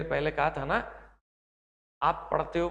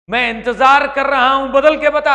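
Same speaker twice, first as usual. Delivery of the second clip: loud, higher challenge from your own mind; inner voice challenging; 8 semitones higher, 13 dB louder than usual. Pretend. Your own mind quizzing you, a loud demanding challenge. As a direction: fire the question at yourself. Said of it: "आपका मन आपसे सवाल कर रहा है".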